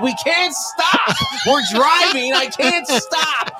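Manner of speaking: high, drawn-out cry on each CAN'T STOP; imitated helpless protest. Retold argument with frozen passenger.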